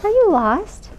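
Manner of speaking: high, cooing voice of a lady charmed by a kitten; sweet lady. Acted. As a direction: coo it up high like she's found a lost kitty. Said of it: "said the lady".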